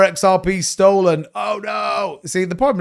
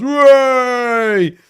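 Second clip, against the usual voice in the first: mock cheer; 8 semitones higher, 4 dB louder than usual. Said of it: "And everyone goes".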